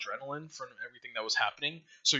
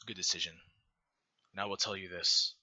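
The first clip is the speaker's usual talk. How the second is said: normal talking voice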